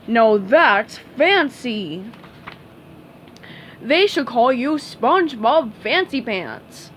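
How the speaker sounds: slow, drawn-out admiring voice; slow proud starfish voice. Acted. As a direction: savor the fanciness slowly.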